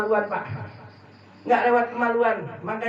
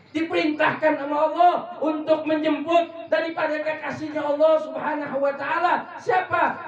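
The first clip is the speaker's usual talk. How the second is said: raised, ringing preacher voice; rousing sermon storytelling; 5 semitones higher than usual. Acted. Preacher revealing who came down from heaven, rousing. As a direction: fire questions at the congregation, pitched high.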